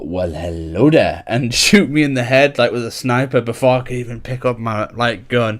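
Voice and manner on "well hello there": low, slow, teasing catchphrase greeting. Retold or imitated. Imitating a friend about to snipe him, low sly greeting. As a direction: drop the pitch and draw it out. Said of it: "he'd go".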